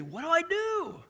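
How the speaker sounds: high, drawn-out plea; pleading retold question. Young friend pleading with pastor for advice, slow and high. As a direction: stretch the question out like a lost young man.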